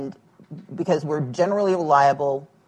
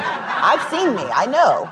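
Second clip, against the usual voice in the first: slow, amused laughing reply; 6 semitones higher, 4 dB louder, slower than usual. Retold or imitated. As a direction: laugh it out, drawn out. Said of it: "I'm like".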